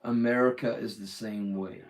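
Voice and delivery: put-on general American; full American accent. Shown as a demonstration. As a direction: switch into an easy American accent. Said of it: "going full American".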